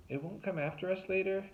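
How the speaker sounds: put-on worried teen girl, high; anxious young girl asking. A narrator's reading. Tuna's voice, high.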